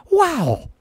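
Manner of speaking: high, drawn-out owl-meme "Wow"; Owen Wilson wow. Retold or imitated. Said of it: "the Owen Wilson meme where it's an owl and it just says".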